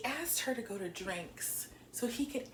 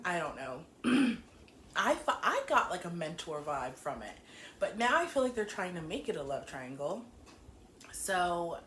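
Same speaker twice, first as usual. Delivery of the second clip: normal talking voice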